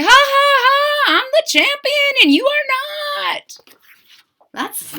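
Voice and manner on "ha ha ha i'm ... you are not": high, mocking laugh, then taunting boast; mean bragging laugh and taunt; mean gloating pug. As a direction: gloat like a spoiled bully. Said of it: "he would be mean about it".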